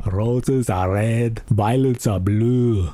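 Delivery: lightly voiced slow, deliberate action-hero recital; Terminator love poem. Terminator reading love poems as a skit, lightly voiced. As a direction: recite love verse slowly, a hint of the Terminator.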